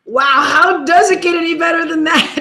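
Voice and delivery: acted-out delighted surprise; retold amazement. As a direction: re-enact being wowed by a windfall. Retelling amazement at big tip.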